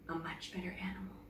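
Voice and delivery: whispered, under the breath; whispered aside. A narrator's reading. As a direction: hiss it to yourself, sulking. Emily muttering to herself.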